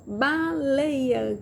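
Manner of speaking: "Baleia" slowly stretched into its syllables; drawn-out syllables. Shown as a demonstration. Teacher showing children the word's pieces, "Baleia" in stretched syllables. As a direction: draw out each piece of "Baleia". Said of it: "Quantos pedacinhos tem?".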